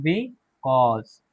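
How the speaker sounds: because drawn out very slowly, bikɔz; slow model word. Shown as a demonstration. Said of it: "because भी पढ़ते हैं".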